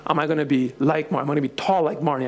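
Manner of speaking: put-on quick, eager child questions; child wondering. Daughter asking about Marnie, quick and eager. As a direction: rush it out with hope.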